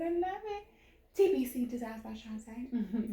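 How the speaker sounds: upbeat pitchman sales voice; infomercial voice. Pretend. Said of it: "Did you see how I went in infomercial voice?".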